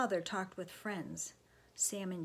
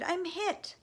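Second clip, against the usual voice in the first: high, raised cry of alarm; 11 semitones higher, 5 dB louder than usual. A narrator's reading. John telling Sam he is shot, crying out. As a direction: cry out as if just struck. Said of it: "John cried".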